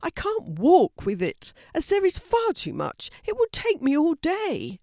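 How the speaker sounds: a postman overwhelmed, voice climbing high; dismay. A narrator's reading. Postman dismayed at the pile of mail, rising high. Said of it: "said in dismay".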